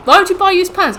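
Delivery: high, loud, quick outburst of disbelief; incredulous question. Acted. Challenging someone over buying used pants, high incredulous outburst. Mock exchange, question asked then repeated slowly.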